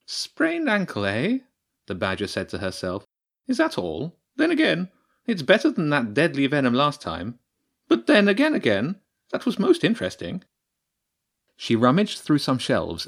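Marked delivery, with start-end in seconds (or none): 0.1-10.4 musing badger priestess. The badger priestess musing to herself over the sprain, high and curious. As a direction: muse aloud like a curious scientist.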